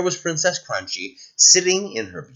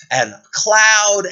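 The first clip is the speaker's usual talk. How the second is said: ordinary delivery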